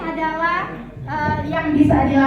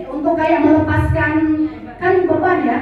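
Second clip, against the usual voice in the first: normal talking voice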